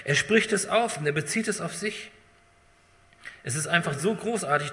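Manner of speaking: normal talking voice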